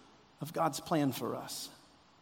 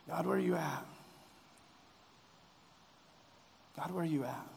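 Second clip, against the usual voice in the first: soft, broken plea, much softer; cries out; 8 dB quieter, faster than usual. Acted. Jesus on the cross crying softly to God. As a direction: let the cry fall away to almost nothing. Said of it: "what he cries out is".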